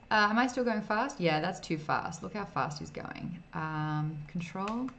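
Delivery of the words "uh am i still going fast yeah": normal talking voice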